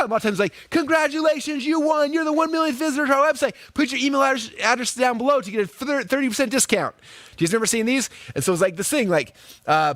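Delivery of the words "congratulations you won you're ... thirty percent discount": high, hammed-up ad announcer; cheesy pop-up ad. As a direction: sell it like a fake prize pop-up. Pop-up ad speaking.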